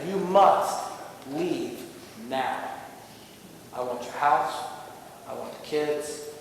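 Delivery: the thief who takes everything, said calmly; menacing intruder. Pretend. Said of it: "and I'm gonna say".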